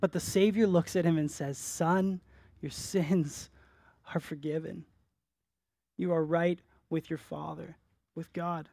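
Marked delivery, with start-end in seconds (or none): 1.6-8.7 tender forgiveness. Acted. Savior speaks to forgiven paralytic.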